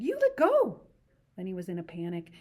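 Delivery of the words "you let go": much higher, louder angry outburst; angry cry. Acted. Curly's wife to Lenny, furious high cry. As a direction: snap at him furiously. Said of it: "she cried".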